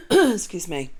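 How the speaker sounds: ordinary talk, nothing marked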